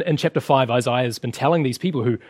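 ordinary talk, nothing marked

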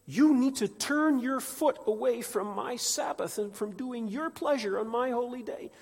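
high, loud impassioned preaching; preaching at full force. Acted. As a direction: preach it with rising fire. Preaching God's words.

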